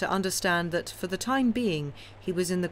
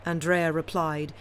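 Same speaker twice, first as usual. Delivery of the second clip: plain reading voice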